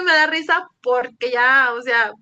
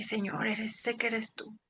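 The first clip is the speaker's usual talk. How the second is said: muy bajito, casi para sí; por dentro; 12 dB quieter than usual. Retold or imitated. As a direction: dilo apenas audible, como hablando por dentro. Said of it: "adentro de mí le dije". (muy bajo, hablándole al Señor por dentro)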